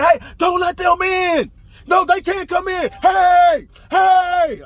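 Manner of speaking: high, full-voiced mock shouts; screaming and yelling. Roommate yelling to keep police out, screaming and yelling. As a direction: yell it high and drawn out, as if overheard. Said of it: "I'm hearing this screaming and yelling".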